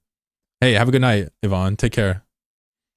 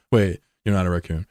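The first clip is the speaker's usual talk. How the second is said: normal talking voice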